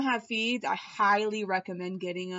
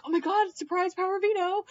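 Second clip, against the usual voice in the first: high, fast, thrilled exclaim; hammed-up giddy shriek, pitch way up; mock excited squeal; 10 semitones higher, faster than usual. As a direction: squeal like a houseguest at a surprise. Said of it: "everyone was like".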